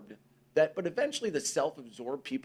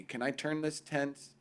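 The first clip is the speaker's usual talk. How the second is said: normal talking voice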